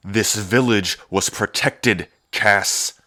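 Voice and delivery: slow, loud, pressing each word; stern rebuke. Acted. Brother scolding his sister for the burnt village, slow and stern. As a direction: lay down the law to her.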